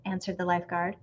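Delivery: usual speaking voice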